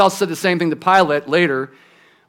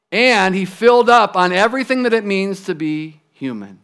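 usual speaking voice

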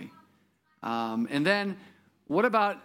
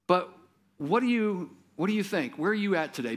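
ordinary delivery

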